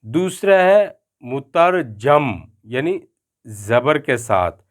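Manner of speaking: ordinary talk, nothing marked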